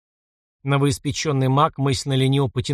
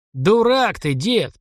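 hoarse rebuking shout, raised voice; 7 semitones higher, much slower than usual. A narrator's reading. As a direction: bark it hoarsely at the old man. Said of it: "прохрипел парень".

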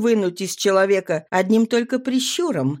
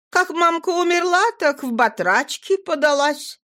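put-on higher, earnest young girl; 8 semitones higher than usual. A narrator's reading. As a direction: speak up as a girl. Nadira voiced.

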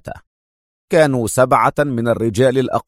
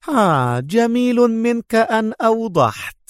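much higher, mocking retort; taunting Mona; 10 semitones higher than usual. Mona taunting the boss while attacking, high and mocking. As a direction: taunt them as you attack. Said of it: "صاحت منى".

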